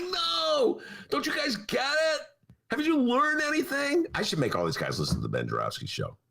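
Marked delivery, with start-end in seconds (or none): 0.0-4.1 higher, shouting in frustration; exasperated shout. Retold or imitated. Host yelling at the legislative leaders, exasperated shout. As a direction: yell at them to learn the lesson. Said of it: "And I'm like".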